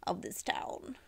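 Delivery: unvoiced whisper trailing off; whispered aside. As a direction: drop to a secret whisper. Whispered end of proclamation.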